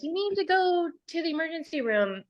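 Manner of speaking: lightly voiced insistent daughter; worried daughter. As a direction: mimic the daughter telling her to get help. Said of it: "My daughter's like".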